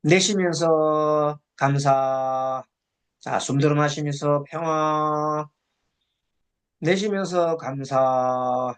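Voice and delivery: slow, level intoned meditation instructions; low, measured meditation-leader monotone; guided breathing meditation. Acted. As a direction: lead the breath slowly, drawing out each phrase ending on a held note.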